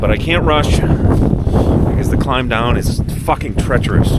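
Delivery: shouting over wind, freezing cold; cold and windy. Real, not acted. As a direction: talk loud against cold wind. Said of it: "I'm freezing my balls off".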